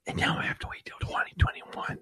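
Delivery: hushed, breathy whisper. Real, not acted. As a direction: whisper the complaint. Whispered complaint about the wait.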